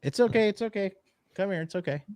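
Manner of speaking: high, soft cooing to a frightened pet; gently calm the scared dog; soothing the dog. Real, not acted. Owner comforting frightened dog in quake, high soft coo.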